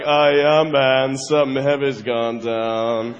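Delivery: low, laid-back suit guy voice; dudes in suits. Acted. Bankers in suits breaking bad news, low and casual. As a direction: drop low and casual, like it's no big deal. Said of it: "they're like".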